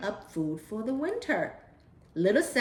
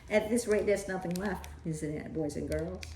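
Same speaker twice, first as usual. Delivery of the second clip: laughing aside; 4 semitones lower, 7 dB quieter than usual. Real, not acted. Reader aside to children.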